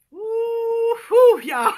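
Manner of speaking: bursting into a high whooping laugh; tickled pink. Real, not acted. Cracking herself up in front of her viewers, whooping laughter. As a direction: crack up at your own joke. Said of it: "I done got myself tickled pink".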